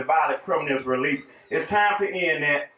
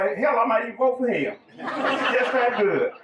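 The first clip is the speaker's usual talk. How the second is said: chuckling as he says it, pitch up and slowing; laughing joke; 6 semitones higher than usual. Real, not acted. Candidate teasing the rival sheriff beside him, laughing and slowing. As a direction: laugh through a teasing compliment to your rival.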